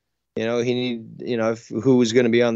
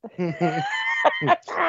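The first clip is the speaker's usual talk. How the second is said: high-pitched burst of laughter; real laugh. Real, not acted. Host cracking up at co-host's crude joke, high laugh.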